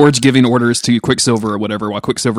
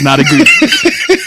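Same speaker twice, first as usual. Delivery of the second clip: laughing; 3 dB louder, much slower than usual. Real, not acted. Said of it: "I am genuinely excited about it".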